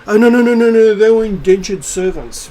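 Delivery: high, loud mock protest; mocking objection. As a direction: mimic an indignant naysayer butting in. Mimics objectors to his facts.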